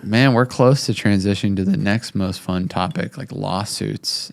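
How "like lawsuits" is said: slow, ominous whisper; dramatic whisper. Acted. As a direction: whisper it like a dark secret. Dreaded next topic teased.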